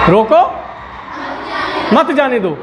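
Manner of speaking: "रोको" left rising, long pause, then "मत जाने दो"; teacher's repeat with a long break after "रोको". Shown as a demonstration. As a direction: hang on "रोको", then finish with "मत जाने दो" as one phrase. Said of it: "रोको" के बाद".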